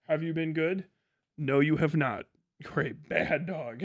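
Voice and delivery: ordinary talk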